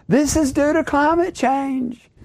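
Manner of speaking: mock the alarmists in a high, slow sneer; mocking quote. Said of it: "they can all say this".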